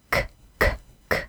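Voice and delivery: crisp voiceless k bursts; short bare k clicks from the back of the tongue; repeated K sound. Shown as a demonstration. Teacher showing students where French R starts, voiceless k sounds. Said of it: "go from the K".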